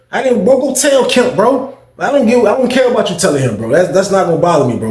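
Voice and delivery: high, heated scolding; telling the snitch off with rising heat; heated callout. Retold or imitated. To the snitch, a high, heated telling-off.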